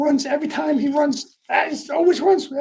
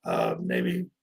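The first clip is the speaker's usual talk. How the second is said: much lower register, trailing softer; Tony Soprano; 7 semitones lower, 4 dB quieter than usual. Real, not acted. Host jokes about their changing voice, low and subdued. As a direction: drop the voice low, half joking. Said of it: "whoever this could be, maybe Tony Soprano".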